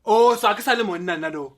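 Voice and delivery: loud indignant retort; indignant husband. Acted. Husband retorting to wife in quarrel, loud and indignant. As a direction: fire back, offended.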